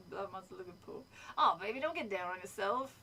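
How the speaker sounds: loose cartoon-character impression; Carl. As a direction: slip into the cartoon character's catchphrase. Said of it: "lines that Carl says".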